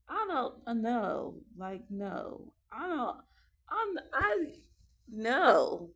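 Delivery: sharp, loud, high stammering no; firm refusal. Retold or imitated. Mom refusing her whining kid, loud and exasperated. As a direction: shut the kid down hard.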